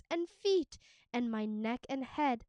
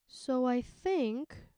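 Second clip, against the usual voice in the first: slow, thoughtful creature voice; dragon voice; much slower than usual. A narrator's reading. Dragon pondering his own name, slow and thoughtful. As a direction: mull it over slowly. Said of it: "the dragon said".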